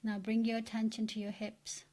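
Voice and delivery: soft, even meditation voice with long pauses; guided meditation. Acted. Meditation leader relaxing listeners from the feet upward, soft and soothing. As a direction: guide them into relaxation, hushed and unhurried.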